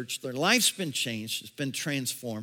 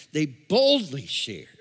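slow phrase with "boldly" raised and punched; stress on boldly; 2 semitones higher, 5 dB louder, slower than usual. Real, not acted. Pastor underlining a point for the congregation, stress on "boldly". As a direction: hit "boldly" hard, then let "shared" fall. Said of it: "put the emphasis on that".

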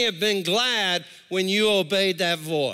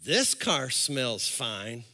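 low, slow savoring voice; mock-smitten car buyer, drawn out low; tempted heart talking; 7 semitones lower than usual. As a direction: relish it slowly, pitched down. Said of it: "My heart says".

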